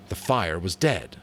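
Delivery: usual speaking voice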